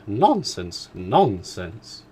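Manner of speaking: mother dismissing her husband; emphatic exclamation. Acted. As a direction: wave it away emphatically. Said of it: "that emphatic exclamation".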